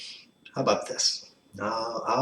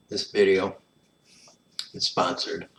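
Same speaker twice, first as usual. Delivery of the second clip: lightly hammed-up sponsor pitch; playful infomercial voice; mock ad read; 2 semitones higher than usual. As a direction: sell the product like a cheesy ad.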